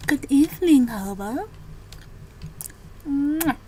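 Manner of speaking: cutesy greeting, blown kiss and giggle; sweet and playful, then giggle; breathy cutesy giggly. Real, not acted. Greets and kisses character.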